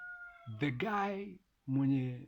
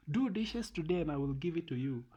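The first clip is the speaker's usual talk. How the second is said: higher, teasing wifely offer; mock bargaining wife; 6 semitones higher than usual. Parodying a wife who trades sex for chores, teasing. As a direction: lightly play the wife dangling a reward.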